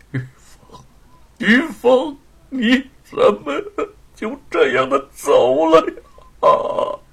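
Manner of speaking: high, loud anguished cry; grief-stricken wail. Acted. Husband clutching his dead wife's hand, wailing. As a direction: wail her name over the coffin. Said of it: "想要放声的狂吼".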